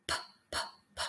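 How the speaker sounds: breathy, voiceless "p" puffs; just the puff of air, no voice; whispered P drill. Shown as a demonstration. Teacher whispering P drills for learners. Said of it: "try to make that sound a couple of times with me".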